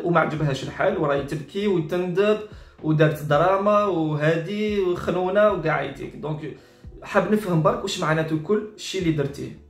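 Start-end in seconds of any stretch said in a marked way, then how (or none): none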